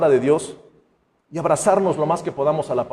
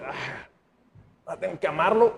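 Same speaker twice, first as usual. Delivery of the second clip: reluctant groan; 7 dB quieter than usual. Retold or imitated. Reluctant believer forced to love.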